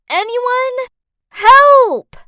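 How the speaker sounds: loud, high desperate shout; shouting for help. A narrator's reading. Trapped woman calls through a locked door, desperate shout. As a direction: call out at full force, pitch way up. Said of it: "slammed my hands against the door and shouted".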